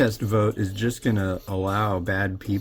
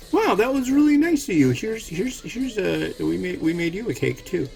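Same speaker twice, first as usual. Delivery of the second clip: squeaky, sugary thank-you, pitched way up; high mock delighted voice; 12 semitones higher than usual. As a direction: gush like an overjoyed neighbor getting a cake. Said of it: "Israel can be like".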